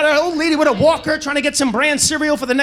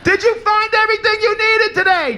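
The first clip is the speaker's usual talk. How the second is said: confused repeat; 9 semitones higher than usual. Retold or imitated. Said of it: "yelling through the glass".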